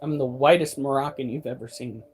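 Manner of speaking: usual speaking voice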